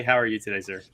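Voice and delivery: ordinary talk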